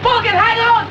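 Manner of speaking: loud high call; shouting after someone. Acted. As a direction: yell to stop someone leaving. Boy calls after departing man.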